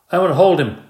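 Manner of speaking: put-on loud eager child demand; eager twin demanding to hold the hamster; announcing loudly. A narrator's reading. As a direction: claim him out loud. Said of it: "Pammy announced".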